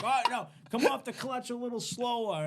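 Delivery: high-pitched, lightly voiced correction; imitated driving coach. Hippie to teen stalling the car, imitated coaching.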